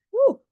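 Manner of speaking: high, loud joyful woo; joyful whoop. Real, not acted. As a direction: let the joy burst out. Joyful release after sermon peak.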